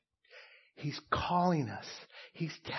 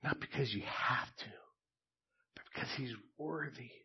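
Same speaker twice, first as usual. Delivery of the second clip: hushed, much softer, breathy awe; reverent whisper; 10 dB quieter than usual. Real, not acted. Pastor to the church on Christ's worth, in a hushed whisper. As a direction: whisper it in worship.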